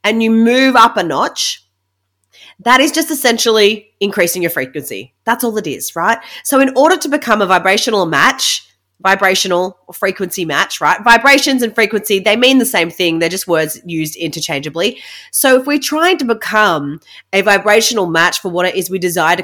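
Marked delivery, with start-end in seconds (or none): none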